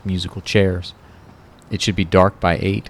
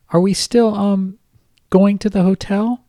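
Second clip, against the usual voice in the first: much higher girlish voice with a hint of a man underneath; a grown man faking a little girl, pitched way up; babyish high voice. Kyle faking Kate's voice on the phone to Ron, high and babyish. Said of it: "his Cuddly Kate voice holding".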